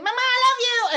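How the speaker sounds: put-on high, loud, stretched-out child's holler; screaming. Son coming home to his napping mother, screaming affectionately. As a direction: bellow it like a son bursting in. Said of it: "he's screaming".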